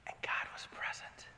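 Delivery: soft breathy whisper of God's presence; the still small voice, whispered. Shown as a demonstration. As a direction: hush it to a whisper. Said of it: "It sounded like this".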